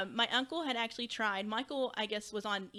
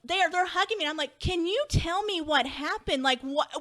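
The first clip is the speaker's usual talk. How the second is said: distraught pleading; 7 semitones higher, 6 dB louder than usual. Retold or imitated. Reliving learning of a death.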